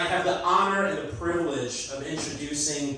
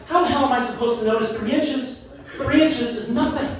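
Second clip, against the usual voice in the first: higher, incredulous protest; exasperated; 8 semitones higher than usual. Retold or imitated. Comic retelling a fight with girlfriend, mock outrage. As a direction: toss it off as ridiculous. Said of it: "I'm getting mad".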